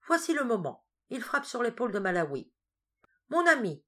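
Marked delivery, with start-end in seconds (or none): 3.3-3.8 paternal major. Acted.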